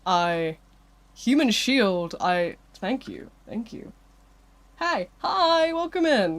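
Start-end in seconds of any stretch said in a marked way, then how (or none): 4.8-6.4 high, bright welcome; excited greeting. Real, not acted. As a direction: greet them with a big lift.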